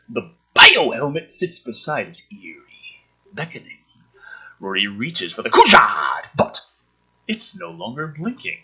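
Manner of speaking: hushed, eerie read on the creepy bits; spooky storyteller read; dramatic script reading. Acted. Reading screenplay aloud dramatically.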